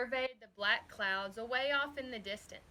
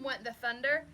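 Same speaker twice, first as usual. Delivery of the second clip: usual speaking voice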